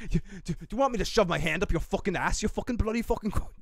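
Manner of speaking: quick mock rant of a crazed chef; sputtering, hammed-up cursing chef; Gordon Ramsay. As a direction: imitate a British chef losing it, without shouting. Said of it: "He gets crazy".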